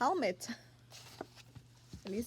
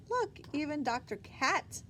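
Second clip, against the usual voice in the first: ordinary talk, nothing marked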